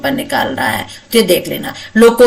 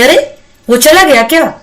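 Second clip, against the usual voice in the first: higher-pitched surprised question; 5 semitones higher than usual. Shown as a demonstration. Teacher demonstrating intonation of surprise at someone leaving, surprised. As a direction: ask it in mild astonishment. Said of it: "यानी आश्चर्य के साथ में".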